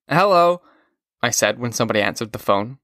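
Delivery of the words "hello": loud slurred hello; drunken yell. A narrator's reading. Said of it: "I sort of yelled it".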